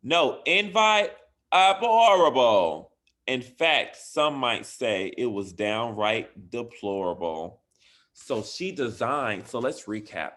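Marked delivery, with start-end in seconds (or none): 0.0-2.9 high, loud indignant gasp of disbelief; mock outrage. Teacher reading, acting shocked that Ray wasn't invited. 3.3-7.6 put-on lively, louder read-aloud for kids; reading a story aloud. Teacher reading a bedtime story to her class, lively and bright.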